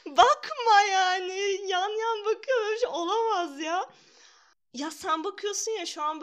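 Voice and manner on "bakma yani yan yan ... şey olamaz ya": high, loud lovestruck squeal; swooning. Pretend. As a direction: swoon over a crush. Swooning over bird photo.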